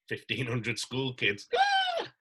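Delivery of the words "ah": horrified gasp. Retold or imitated. Realising his slip on stage.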